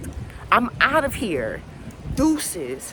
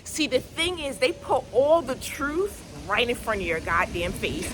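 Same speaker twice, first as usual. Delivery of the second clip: ordinary talk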